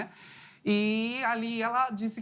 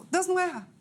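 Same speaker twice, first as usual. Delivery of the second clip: firm joyful affirmation; 9 semitones higher than usual. Retold or imitated. Girl repeating to herself God makes no mistakes, higher and bolder. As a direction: say it again with growing certainty.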